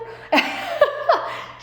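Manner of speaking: cracking up; high burst of laughter. Real, not acted. Laughing at her own story, high burst.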